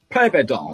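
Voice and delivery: ペイペイドーム rushed, its "pei" turned to "pai"; odd pronunciation. Real, not acted. Naming the stadium to a friend, ペイペイドーム oddly pronounced as "paipai". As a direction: say ペイペイドーム quickly as "paipai", slurring the end. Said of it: "その発音か".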